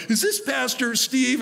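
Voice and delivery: high, slow, curious mock question; drive-thru worker asking if it's the pastor, high and drawn out. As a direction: lightly play the worker asking in disbelief. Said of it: "retold drive-thru worker".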